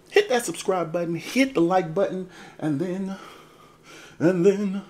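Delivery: high, loud hammed-up urging; hyped-up plug. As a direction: build to a high, loud peak. Hyped call to subscribe.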